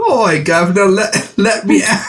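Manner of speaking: high, hammed-up fake Australian; badly faked Australian accent. Co-host playing the doll as Australian, badly faked Australian accent. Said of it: "she's Australian".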